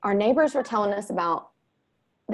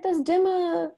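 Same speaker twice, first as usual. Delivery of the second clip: put-on much higher, the boy stumbling over the word; mother imitating her son, higher and halting; fumbling kid voice; 11 semitones higher, slower than usual. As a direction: play a child who can't finish the word.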